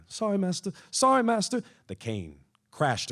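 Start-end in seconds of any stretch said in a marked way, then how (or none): 0.1-0.7 higher, cringing and eager to please; grovel, voice pitched up in fear; simpering apology. A narrator's reading. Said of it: "Chester simpered and bowed down". 0.9-1.6 much higher and louder, near a cry; desperate pleading. A narrator's reading. Chester pleading with master Terrence as the cane falls, high and loud. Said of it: "Chester simpered and bowed down".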